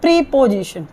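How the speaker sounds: "प्रीपोजिशन" opening with pree; students' mispronunciation. Shown as a demonstration. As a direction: say "प्रीपोजिशन" with a long EE. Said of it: "विद्यार्थी बोलते हैं". ("प्रीपोजिशन" की शुरुआत लंबे प्री से)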